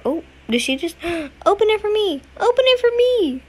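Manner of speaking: high-pitched disbelief; rising astonishment; surprised. Real, not acted. Surprised NPC opens door.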